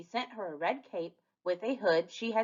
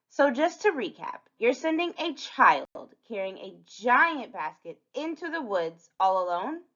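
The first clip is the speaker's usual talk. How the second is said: put-on higher-pitched, questioning child character; incredulous Little Red; 5 semitones higher than usual. Red questioning being sent into the woods, incredulous. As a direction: recap it in pointed disbelief, pitched up.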